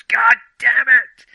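imitating the character's sudden furious yell; angry outburst. Reviewer imitating Kay banging the table, loud mock yell. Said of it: "where he goes".

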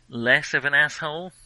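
ordinary delivery

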